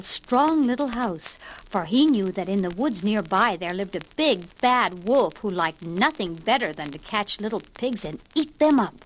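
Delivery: usual speaking voice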